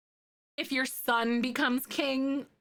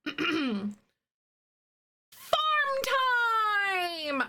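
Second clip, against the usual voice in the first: excited announcement; 10 semitones higher than usual. Real, not acted. Streamer to chat, starting the farm build, excited call. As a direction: announce it like a game show.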